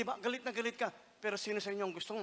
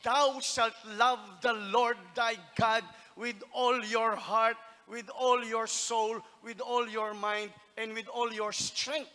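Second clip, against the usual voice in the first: slow, loud preaching of scripture; measured declaration of a commandment; solemn scripture quote; 2 semitones higher, 6 dB louder, slower than usual. Retold or imitated. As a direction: proclaim each line with weight. Quoting Jesus solemnly.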